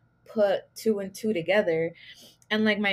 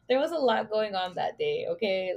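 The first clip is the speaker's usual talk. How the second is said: normal talking voice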